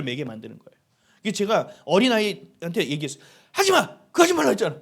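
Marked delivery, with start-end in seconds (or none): 3.5-4.8 loud, high-pitched telling-off; angry scolding. Retold or imitated. Father retells scolding his small child out of fear, angry shout. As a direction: re-enact an exasperated parent's outburst, full voice. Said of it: "막 이러면서 막 혼을 냈어요".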